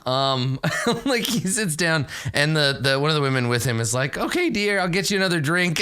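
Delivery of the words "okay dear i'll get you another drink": high, soothing companion voice; placating woman. Acted. Game master as woman calming her drunk companion, placating.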